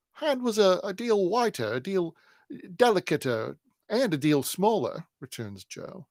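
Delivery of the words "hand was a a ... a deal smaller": put-on high, loud ragged boy's voice; Jo, a high street urchin voice. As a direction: play the poor crossing-sweeper boy, insistent. Jo answers Bucket.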